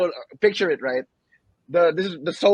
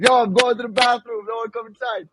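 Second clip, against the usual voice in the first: higher, mild mock yell to the house; retold scream; 7 semitones higher, 3 dB louder than usual. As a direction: imitate calling out a warning to everyone. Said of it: "I would just scream to everyone".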